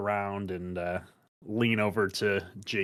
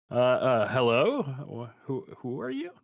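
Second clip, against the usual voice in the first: stammered, startled greeting rising into a higher question; slightly startled; 4 semitones higher, 3 dB louder than usual. Acted. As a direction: stumble over the words, mildly caught off guard. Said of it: "slightly startled".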